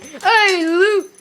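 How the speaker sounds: high, loud call to a pet; calling the dog. Real, not acted. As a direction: call out to get the dog's attention.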